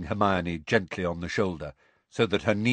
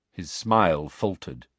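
usual speaking voice

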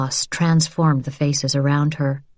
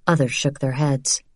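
ordinary delivery, nothing marked

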